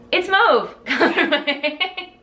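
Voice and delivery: high, loud, drawn-out delight with laughter; delighted exclamation. Real, not acted. Showing a birthday gift yarn, laughing delight. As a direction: exclaim it slowly, high and tickled. Thrilled by gifted yarn colorway.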